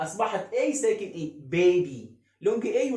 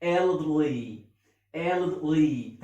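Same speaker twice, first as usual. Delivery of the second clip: put-on elderly stretched very slowly, syllable by syllable; split into syllables; 2 semitones lower, much slower than usual. Shown as a demonstration. Teacher slowly splitting elderly into parts for students. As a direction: break elderly apart slowly. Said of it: "هقسمها ازاي".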